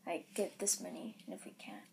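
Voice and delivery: hushed unvoiced aside; whispering. Real, not acted. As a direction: whisper it just to your partner.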